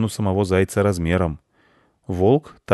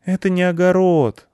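put-on resigned little boy; sighing boy; 11 semitones higher, 4 dB louder than usual. A narrator's reading. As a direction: sigh the correction out up high. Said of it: "вздохнул мальчик".

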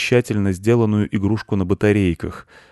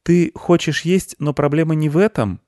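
put-on high-pitched young boy, taking his time; little boy; 9 semitones higher, 4 dB louder than usual. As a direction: puzzle it out in a high child voice. Boy questions the beetle.

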